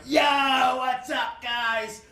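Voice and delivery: high, drawn-out yelled hello; mock shouted greeting. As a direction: burst in calling out to the guys. Hack bursting into hotel room.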